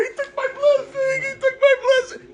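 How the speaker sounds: very high, squealing fake sob; hammed-up tearful wail to a reporter; mock crying. Esav crying to the CNN reporter, mock sobbing. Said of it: "he's crying to the C N N reporter".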